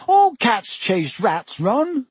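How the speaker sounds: high, drawn-out pleading; voice shaking. A narrator's reading. Hermione excusing her cat to Ron, high upset plea. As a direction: protest slowly and high, mildly upset. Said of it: "her voice shaking".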